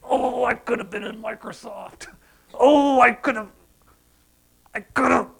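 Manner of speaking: high, strained moan of regret; lost-opportunity regret. Acted. Preacher voicing someone who missed Microsoft, high pained regret. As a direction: moan it, breaking off unfinished. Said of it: "Think of the lost opportunity".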